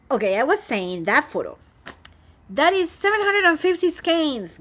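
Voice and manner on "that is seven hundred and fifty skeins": excited. Real, not acted.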